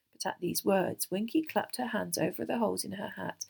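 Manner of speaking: ordinary delivery, nothing marked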